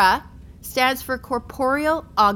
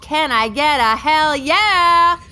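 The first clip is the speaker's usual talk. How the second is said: louder, higher, slow hype; hype call; 5 semitones higher, 6 dB louder, slower than usual. Acted. Guide rallies bored students on field trip, loud hype call. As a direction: pump up a sleepy class. Tour guide rallying kids.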